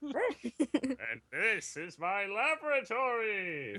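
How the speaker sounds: slow, drawn-out Plankton villain voice; lightly voiced Plankton gloating over his lab; Plankton impression.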